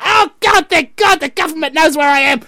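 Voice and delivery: high, loud, hammed-up gushing; mock relief. Tracked citizen thanking God for government watch, mock relief. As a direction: cry it out high in mocking gratitude. Mock gratitude of compliant public.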